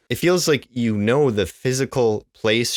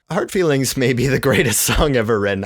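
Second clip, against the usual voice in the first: laughing excitement; 5 semitones higher, 4 dB louder than usual. Real, not acted. Gushing over favorite song.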